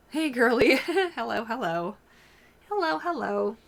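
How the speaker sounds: high, sing-song greeting to the dog; pet talk. Real, not acted. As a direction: coo hello at the dog.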